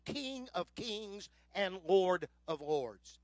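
drawn out, every word weighted; slow and solemn, letting each title land; slow, solemn proclamation. Acted. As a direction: majestic slow reading of the title. Climax of scripture reading.

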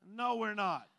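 normal talking voice

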